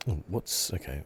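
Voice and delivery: ordinary delivery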